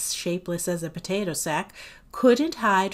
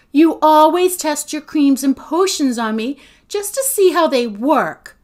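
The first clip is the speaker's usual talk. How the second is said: loud, raised-pitch complaint; indignant accusation; 7 semitones higher, 10 dB louder than usual. A narrator's reading. Agatha complains of being Sophie's test subject, indignant.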